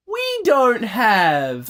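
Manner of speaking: high, slow, exaggerated offended whine; indignant mock outrage. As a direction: act deeply insulted, drawn out. Mocking Australian outrage at game.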